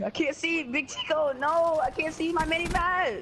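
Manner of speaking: high panicked yelling; panic, can't see the map. Real, not acted. Panicking mid-fight.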